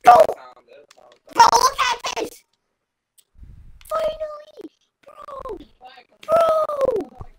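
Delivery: high, thrilled shouts; excited shouting. Real, not acted. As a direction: yell it out in high delight. Excited shouting while gaming.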